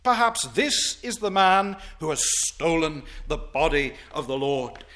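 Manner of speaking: ordinary delivery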